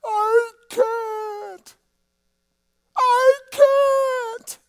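very high, drawn-out wailing cry. Retold or imitated. Preacher reliving crying out alone on a Cairo street, wailing. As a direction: wail each word long, as if breaking down. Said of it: "I cried out".

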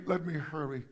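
normal talking voice

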